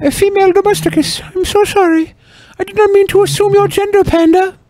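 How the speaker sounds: high mock apology; squeaky fake apology.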